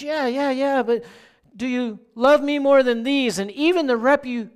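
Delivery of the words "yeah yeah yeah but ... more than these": higher, louder pressing question; Jesus asking Peter a second time, louder and higher; insistent repeat. Retold or imitated. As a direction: push the question again, urging. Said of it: "he says it again".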